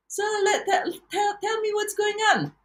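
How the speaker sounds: high, coaxing coach voice; coach's voice. Retold or imitated. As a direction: lift the voice way up to play the coach. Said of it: "my coach who says".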